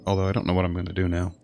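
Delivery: normal talking voice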